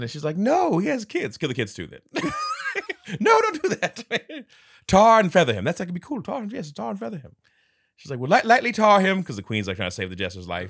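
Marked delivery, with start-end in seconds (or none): 0.4-4.0 high, loud, laughing plea; queen pleading with the king, high and loud with laughter; mock pleading. 4.9-9.2 high, loud insistence; queen bargaining loudly for the jester's life; mock bargaining.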